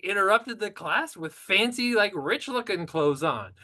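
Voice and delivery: high, loud fake realization; hammed-up dawning surprise, pitched very high; mock surprise. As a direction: squeal it like a sudden realization. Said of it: "And I was like".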